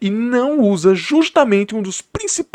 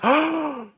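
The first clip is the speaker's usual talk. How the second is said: mock gasp; 10 semitones higher, 6 dB quieter than usual. Mock shock at plot twist.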